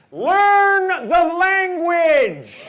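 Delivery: high, slow, drawn-out yell; mock angry shout. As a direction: bellow it like an outraged bigot. Ranting at immigrants.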